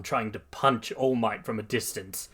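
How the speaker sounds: usual speaking voice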